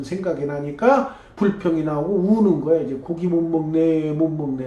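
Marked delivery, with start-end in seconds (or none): 3.0-4.7 mock whining. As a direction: whine it like a crying crowd. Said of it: "하고 울어요".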